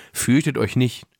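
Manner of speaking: usual speaking voice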